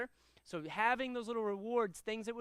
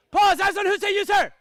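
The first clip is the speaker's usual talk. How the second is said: shouted much higher and much louder; loud command; 11 semitones higher, 19 dB louder than usual. Shown as a demonstration. Karate instructor showing students how to call the class, shouting. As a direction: bark it across the whole room to snap the class to attention. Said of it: "It's a good volume".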